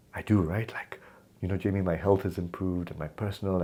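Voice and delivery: hushed, secretive whisper; confiding whisper. Retold or imitated. Client confiding about his forum.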